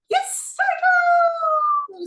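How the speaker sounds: very high, slow crowing voice; high drawn-out cockerel reply. Acted. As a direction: stretch it like a proud rooster. Cockerel answers.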